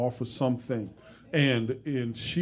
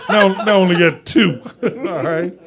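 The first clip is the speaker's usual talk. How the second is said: fast laughing punchline, higher and louder; 6 semitones higher, 9 dB louder than usual. Real, not acted. Host joking to guest about meeting him, laughing. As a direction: rattle it off while cracking up.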